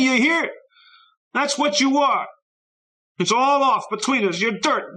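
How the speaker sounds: put-on high, loud, slow furious boy's yelling; furious shout. A narrator's reading. Boy yelling at the friend who left, high furious shouting. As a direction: hurl it at the empty street, high and loud.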